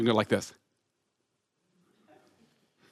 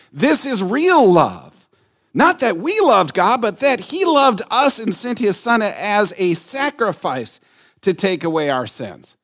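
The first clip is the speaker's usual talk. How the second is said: louder, higher, slow proclamation; proclaiming; 9 semitones higher, 7 dB louder, slower than usual. Acted. Preacher proclaims the NLT verse to the church, rising. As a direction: declare it to the back row. Rereading in new translation.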